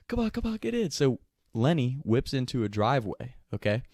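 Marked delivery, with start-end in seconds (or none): 0.1-0.9 urgent high call. Retold or imitated. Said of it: "They were like".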